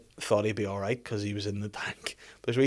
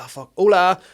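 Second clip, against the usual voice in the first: flustered; 7 semitones higher, 11 dB louder than usual. Retold or imitated. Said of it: "and I was like".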